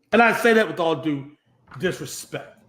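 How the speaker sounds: ordinary talk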